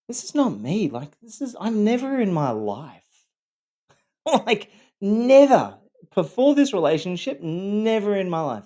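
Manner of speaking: high, strained protest; retold yelling. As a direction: imitate protesting in disbelief, voice shooting up. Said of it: "I'd be screaming and yelling".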